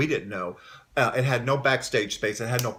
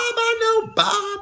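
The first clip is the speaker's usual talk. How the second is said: mock recognition; 4 dB louder, much slower than usual. Imitating singer recognizing Bob.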